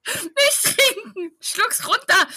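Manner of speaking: high, slow, laughing yell; mock shouting. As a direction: shout the order through laughter. Acting scene with machine gun.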